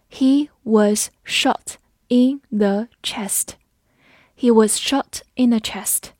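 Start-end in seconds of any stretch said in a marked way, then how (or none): 0.1-3.5 very slow, every word set apart; slow read-along. Shown as a demonstration. Teacher leading learners through the example sentence, slowly. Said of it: "我们慢慢来读".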